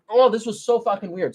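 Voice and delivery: normal talking voice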